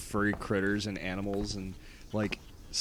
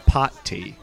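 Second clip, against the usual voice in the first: ordinary talk, nothing marked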